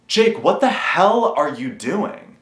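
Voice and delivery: high, slow exasperated outcry; exasperated self-rebuke. Real, not acted. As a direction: plead with yourself in disbelief. Scolding himself on camera.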